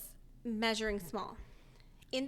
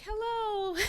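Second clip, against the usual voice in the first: cheery doctor greeting; 11 semitones higher, 4 dB louder than usual. Retold or imitated. Doctor voiced on iPad.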